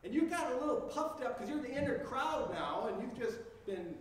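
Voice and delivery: ordinary talk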